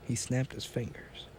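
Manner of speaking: plain reading voice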